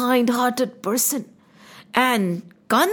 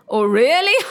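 scornful laugh from above; mocking laugh. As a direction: laugh at the fool. Divine voice mocks Kans.

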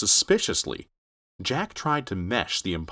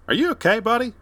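high friendly man's voice; 13 semitones higher, 5 dB louder than usual. Acted. Truck driver Ted speaking.

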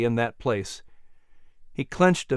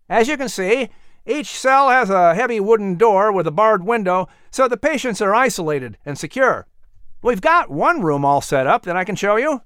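put-on higher, eager young man's voice; a helpful workman greeting, pitched up; young worker; 6 semitones higher than usual.

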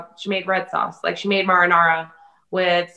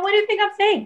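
exasperated pleading; 13 semitones higher, faster than usual. Retold or imitated. Speaker pleading with mom.

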